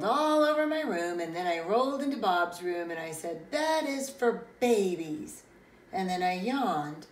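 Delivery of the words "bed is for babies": defiant declaration. Acted. Child defiantly refuses bed.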